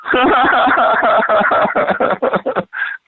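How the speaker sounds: long high giggling laugh. Real, not acted. Hearing the dog is named after the alcoholic: real laughter. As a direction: laugh at the joke name.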